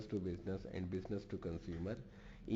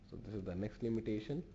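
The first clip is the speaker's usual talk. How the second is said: ordinary talk, nothing marked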